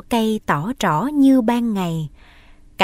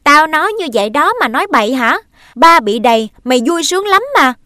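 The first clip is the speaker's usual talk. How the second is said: loud, high and fast; angry quarrel; 6 semitones higher, 7 dB louder, faster than usual. Acted. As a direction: hurl the words at her. Brother and sister quarrel.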